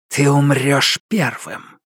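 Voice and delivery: slow menacing shadow voice; creeping nightmare whisper. Acted. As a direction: threaten slowly like a dark spirit. Shadow threatens back.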